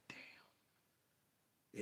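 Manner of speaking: hushed whispered damn. Retold or imitated. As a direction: breathe it out in disbelief. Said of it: "I was like".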